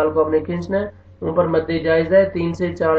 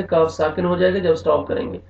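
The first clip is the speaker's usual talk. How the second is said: ordinary delivery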